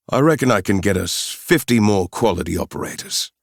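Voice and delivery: put-on confident South African accent; assured South African mercenary. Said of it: "With a strong South African accent".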